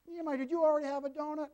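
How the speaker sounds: lightly voiced mom, quick and high; quoting mom. Grandpa imitating the boy's mother checking on donuts, quick and high.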